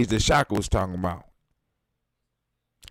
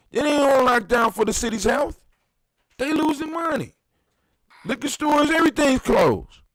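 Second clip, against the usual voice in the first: ranting; 9 semitones higher than usual. Real, not acted. Ranting about the lockdown.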